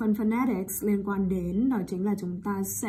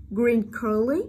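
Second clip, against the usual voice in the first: "Green curry?" with "curry" swept upward; rising intonation; slower than usual. Shown as a demonstration. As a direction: lift the end of "curry" to ask. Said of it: "ngữ điệu đi lên". (lên giọng ở cuối "curry")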